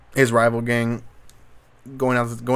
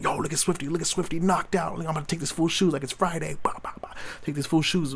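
mock taunting, much higher, dropping to a whisper mid-way; gloating filming a knockout; 6 semitones higher than usual. Imagining recording Swifty knocked out, mocking gloat. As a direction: gloat like you're filming the fallen rival.

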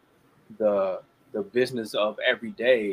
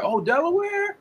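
much higher, loud hype-man cheer; playful emcee shout-out; hyped crowd host; 8 dB louder than usual. Retold or imitated. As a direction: pump up the crowd from the stage. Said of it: "and I ask people".

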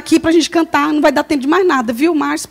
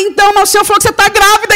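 fast, higher blurt; quick blurt; 5 semitones higher, much faster than usual. Retold or imitated. Telling the coworker God's message, rushed. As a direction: rush it out awkwardly. Retold quick blunt reply.

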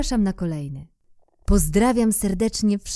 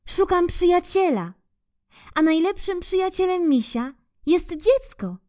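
little bear; 11 semitones higher, 4 dB louder than usual. Acted. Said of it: "Dodał".

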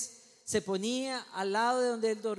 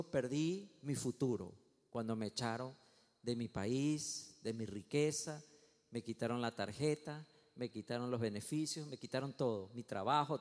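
low, soft listing of losses; subdued lament; 7 semitones lower, 4 dB quieter than usual. Acted. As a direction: voice a downcast man's complaint, quietly. Moses voiced lamenting his losses.

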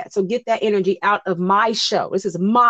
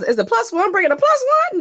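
incredulous; 14 semitones higher, 5 dB louder than usual. Real, not acted. Incredulous rhetorical question.

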